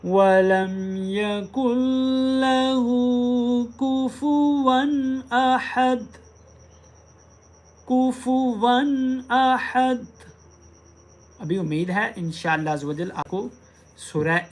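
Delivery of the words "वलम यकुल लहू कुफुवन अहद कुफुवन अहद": slow, high melodic tilawat; slow sung Quran recitation. Acted. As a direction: chant the surah in long melodic lines. Recites Surah Ikhlas.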